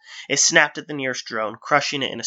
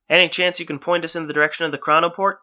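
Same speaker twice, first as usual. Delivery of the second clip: higher call over the shoulder; 5 semitones higher, 4 dB louder than usual. Acted. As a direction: raise it above the chase. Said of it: "he called over his shoulder".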